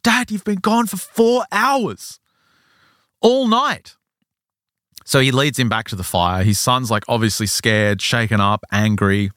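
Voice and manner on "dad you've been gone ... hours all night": slow, very high-pitched reproach; acted-out upset son, pitched way up; indignant accusation. Son telling dad how long he was gone, indignant. Angry son corrects father.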